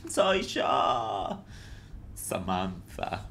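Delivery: voz impostada de diva, pausada; presentación teatral. Pretend. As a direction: saluda como una diva exagerada. Saludo teatral de apertura. (saludo de diva impostado)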